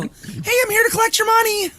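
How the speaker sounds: put-on high, loud kid voice demanding money; squeaky shouting paper boy; paperboy voice.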